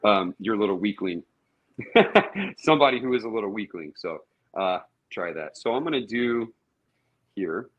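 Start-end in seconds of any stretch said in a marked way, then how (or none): 1.8-2.5 laugh. Real, not acted. Jokes about the weakling.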